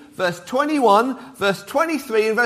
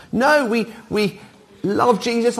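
ordinary talk

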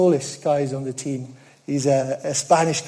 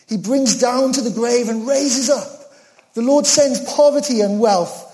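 raised, ringing scripture reading; 3 semitones higher than usual. Acted. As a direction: proclaim the verses to the congregation. Reads scripture in preaching voice.

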